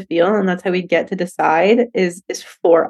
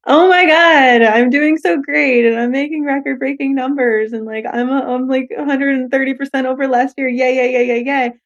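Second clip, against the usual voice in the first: gushing excitement; 4 semitones higher than usual. Retold or imitated. Her on a record year, gushing with mock excitement. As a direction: celebrate like the numbers just came in. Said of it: "I have to be able to be like".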